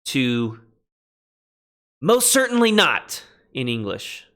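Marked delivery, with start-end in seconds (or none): 2.0-3.2 "most certainly not", much higher and stressed on "certainly"; strongest denial. Shown as a demonstration. Teacher giving the English for the strongest negation, emphatic. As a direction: hit "certainly" hard, high, then drop. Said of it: "strongest prohibition or negative equivalent".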